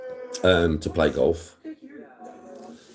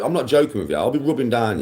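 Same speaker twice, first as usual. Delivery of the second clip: normal talking voice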